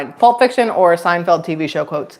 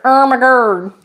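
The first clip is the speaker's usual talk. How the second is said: higher, put-on goofy voice; silly exclamation; 4 semitones higher, slower than usual. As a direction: play the exclamation up as a joke. Echoes child's exclamation in silly voice.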